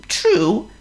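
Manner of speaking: very high squeaky worm. Acted.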